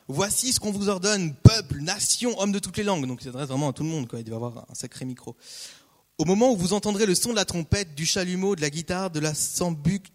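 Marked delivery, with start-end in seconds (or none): 0.1-3.1 herald's proclamation. Acted. Preacher reading the herald's decree, loud proclamation. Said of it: "un héros cria à haute voix". 6.2-10.1 loud town-crier announcement; herald's proclamation. Acted. Preacher reading the king's herald, loud proclamation. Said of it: "un héros cria à haute voix".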